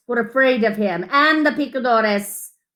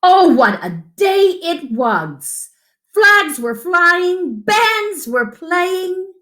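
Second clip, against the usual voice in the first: higher, slow and marvelling; excited storytelling; 7 semitones higher, slower than usual. A narrator's reading. Reading to a child about the bullfight day, delighted. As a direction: paint the festive day with wonder.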